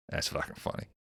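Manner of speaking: a breathy aside to himself; quiet whisper under the breath. Real, not acted.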